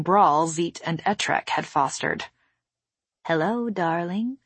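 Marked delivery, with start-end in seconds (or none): 3.3-4.4 sweet-talking, as if to a beloved gadget; gentle cooing greeting; soft coaxing. A narrator's reading. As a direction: softly coax it like a sweetheart. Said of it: "said softly in the voice she used to speak to wires".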